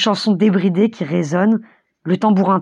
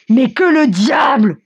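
high, drawn-out angry outburst; furious cry; 6 semitones higher, 4 dB louder, slower than usual. A narrator's reading. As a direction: curse in fury, slow and high. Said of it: "s'écrie Mikolka en furie". (cri de fureur, haut et traînant)

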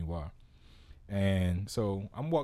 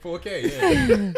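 laughing; 9 dB louder, much slower than usual. Real, not acted. Joking echo with laugh.

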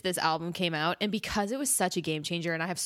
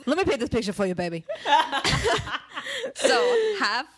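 laughing; 9 semitones higher, 5 dB louder than usual. Real, not acted. Laughing through her setup.

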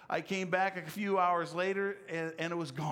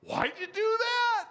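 much higher, louder squeak of disbelief; high, loud incredulous scold; mock scolding; 6 dB louder than usual. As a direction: shriek it like an exasperated scold. Imagined scolding of himself.

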